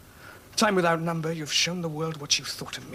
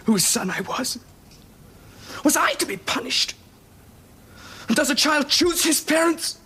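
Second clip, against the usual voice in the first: on the verge of tears; 10 semitones higher than usual. Acted. Son pleading with his father, close to crying. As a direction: let the voice crack as tears come. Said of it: "Now, don't cry".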